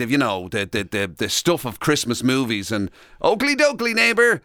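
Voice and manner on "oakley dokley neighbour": higher-pitched mock-friendly neighbour greeting; cheery movie neighbour. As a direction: sing out a hammed-up neighbourly hello.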